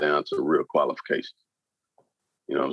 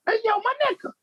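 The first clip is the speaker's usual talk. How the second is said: mock high New York woman; faster than usual. Mocking New York women's greeting, squeaky and much higher. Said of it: "They be like".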